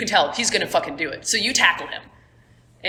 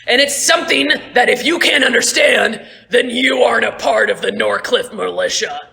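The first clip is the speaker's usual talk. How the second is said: loud, higher disdainful scolding; scornful lecture; 4 semitones higher, 5 dB louder than usual. Acted. Merrick scolding Stonebreaker mid-fight, loud and scornful. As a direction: talk down to the traitor.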